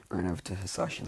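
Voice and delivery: plain reading voice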